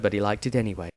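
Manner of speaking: ordinary delivery, nothing marked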